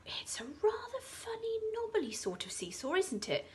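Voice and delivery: put-on older girl unsure about the seesaw; doubtful older girl. A narrator's reading. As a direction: ask it warily. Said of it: "a girl, who was older".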